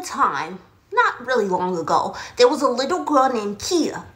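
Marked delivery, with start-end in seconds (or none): none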